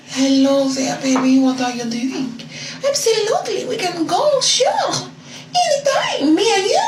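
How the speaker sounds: high, sing-song put-on French accent, flirting; sweet-talking him in a fake French accent; mock French accent. To her date, flirting in a high mock French accent. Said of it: "I spoke in his French accent".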